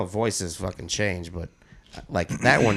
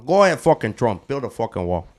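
Tony Montana. Retold or imitated. Riffing on the comic's joke in a mock Cuban accent. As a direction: put on a movie-gangster Cuban accent. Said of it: "doing, like, Tony Montana".

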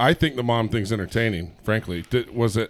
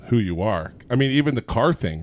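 ordinary talk

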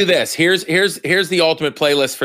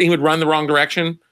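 normal talking voice

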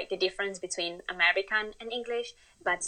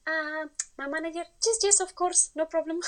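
flustered and much higher, mock panic; flustered yes; 8 semitones higher than usual. Asked for the manager, answering in a higher flustered squeak. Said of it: "And I was like".

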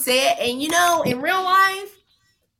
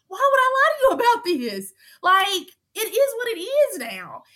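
high-pitched, incredulous retold plea; exasperated, voice jumping way up; exasperated protest; 9 semitones higher than usual. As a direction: plead your case in a much higher voice. Retelling her exasperated plea to housemate.

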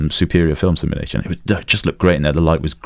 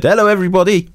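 much higher, bright mock-cheery greeting; really cheery; 12 semitones higher than usual. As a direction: greet everyone like rescuing them is fun. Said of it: "First thing he said".

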